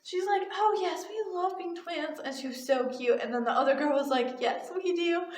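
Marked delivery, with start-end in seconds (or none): 0.5-2.2 put-on high little-kid voice; child's eager answer, pitched up; little twin. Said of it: "they had the same voice". 4.4-5.2 lightly imitated second twin, higher; high small-child reply; little twin. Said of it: "they had the same voice".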